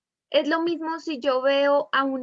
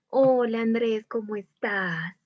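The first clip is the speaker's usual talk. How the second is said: drawn-out, coquettish hello; flirty; slower than usual. Shown as a demonstration. Teacher showing students how tone changes a greeting, flirty. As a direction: greet Andrés slowly and flirtatiously. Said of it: "Que uno suena más coqueto".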